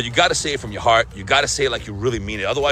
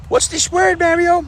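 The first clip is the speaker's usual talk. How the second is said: much higher, curious viewer voice; high-pitched viewer question; viewer asking; 4 dB louder than usual. Acted. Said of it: "viewer asks".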